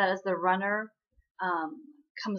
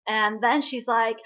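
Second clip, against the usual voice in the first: ordinary talk, nothing marked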